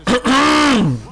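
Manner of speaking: a sharp, loud clear of the throat. Real, not acted. Pulling himself together for the camera, he clears his throat loudly. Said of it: "get myself together".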